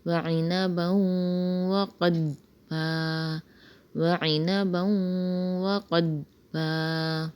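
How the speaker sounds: slow, drawn-out tajweed recitation; slow Quran recitation. Shown as a demonstration. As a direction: recite the ayah slowly with stretched vowels. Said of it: "پوری آیت کو اس طرح سے پڑھا جائے گا".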